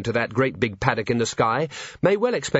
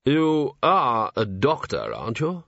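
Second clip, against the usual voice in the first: slow weak patrician; 2 semitones higher, slower than usual. Acted. Sick patrician speaks.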